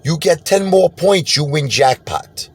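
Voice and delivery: high, slow, drawn-out put-on voice; casino guy's voice. Casino man tells the gambler he's doing well, high and slow. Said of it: "He go".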